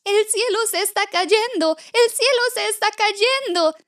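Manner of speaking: very high, panicked little chick voice; scared storybook chick raising the alarm; frightened chick. Acted. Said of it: "¿Por qué estás tan asustado?".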